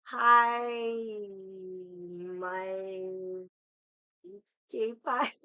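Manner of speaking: halting, drawn-out sounding out; struggling to pronounce. Real, not acted. Greeting a viewer with a hard name, halting. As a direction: feel your way through an unfamiliar name. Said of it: "I can't pronounce it".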